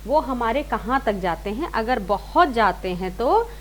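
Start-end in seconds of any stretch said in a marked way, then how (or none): none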